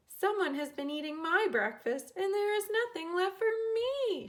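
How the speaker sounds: put-on childlike upset cry, climbing high. A narrator's reading. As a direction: wail for the lost breakfast. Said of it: "she cried".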